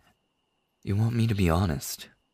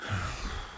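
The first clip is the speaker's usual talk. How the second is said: weary sigh; 9 dB quieter than usual. Acted. Weary confession before opening up.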